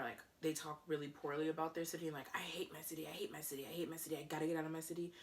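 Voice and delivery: ordinary delivery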